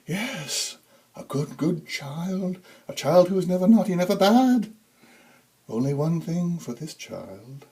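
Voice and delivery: high, creepy, crooning Santa; eerie high tenor. A narrator's reading. Creepy Santa gloating over the child, high eerie croon. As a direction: croon it hungrily, high and strange. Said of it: "His voice was a high tenor with breathy overtones".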